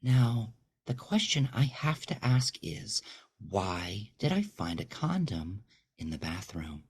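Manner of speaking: high, icy, half-whispered questioning; ruthless interrogation. Acted. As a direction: corner him word by word. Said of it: "only makes her more ruthless".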